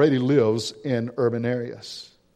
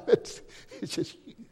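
soft, breathless laugh through the words; chuckling; 8 semitones higher, 7 dB quieter than usual. Real, not acted. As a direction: let the words dissolve into a real chuckle. Chuckles at his own comparison.

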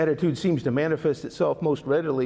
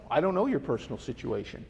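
ordinary talk, nothing marked

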